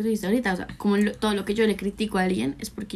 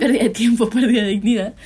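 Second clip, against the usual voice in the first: laughing; 2 semitones higher, 6 dB louder than usual. Real, not acted. Laughing at own joke.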